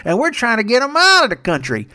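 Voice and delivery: high, slurred Southern politician voice; Jeff Sessions. Acted. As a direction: do a caricature Southern senator. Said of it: "Jeff Sessions ... and he's like".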